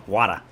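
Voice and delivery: low "Wada" with a d for the t; New York pronunciation. Shown as a demonstration. New Yorker spelling out his pronunciation, "Wada" with no r. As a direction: say "Wada" as spelt, w-a-d-a. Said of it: "W A D A".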